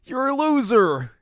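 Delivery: high, loud taunting squeak with laughter; mocking villain. Naraku taunting Inuyasha, high and loud with laughs. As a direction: jeer at him like a petty cackling villain. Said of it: "And then Naraku's like".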